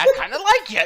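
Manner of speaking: high, loud mock wrestler voice; imitating a wrestler. Echoing co-host's wrestler impression, high and loud. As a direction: belt out the quoted line playfully. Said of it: "And then he's like".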